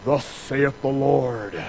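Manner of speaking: slow, solemn mock proclamation; mock grandiose prophet voice. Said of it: "we're gonna go".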